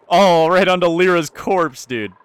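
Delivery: loud, high, slowed oh; dismayed exclaim. Real, not acted. Streamer watching food land on a corpse, loud dismay. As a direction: groan it out in disbelief. Food falls onto dead colonist.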